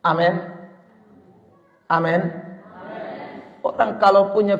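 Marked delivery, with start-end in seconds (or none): none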